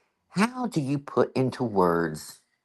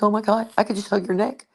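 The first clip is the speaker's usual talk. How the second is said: relieved gush; 13 semitones higher than usual. Retold or imitated. Relief at officer's reply.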